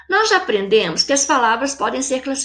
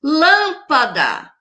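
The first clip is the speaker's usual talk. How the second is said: "Lâmpada" very slowly, weight on "Lâm"; strong first syllable; 2 semitones higher, much slower than usual. Shown as a demonstration. Teacher showing pupils the strong syllable, "Lâmpada" drawn out, stressed on "Lâm". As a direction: stretch and stress the opening of "Lâmpada". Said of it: "a lâmpada teve a antepenúltima sílaba forte".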